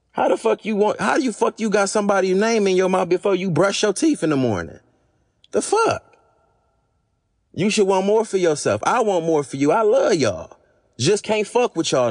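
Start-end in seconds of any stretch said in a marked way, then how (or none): 5.5-6.1 high-pitched incredulous outburst; indignant disbelief. Real, not acted. Scolding gossipers over their name-dropping, indignant. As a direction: throw it out sharply high, appalled.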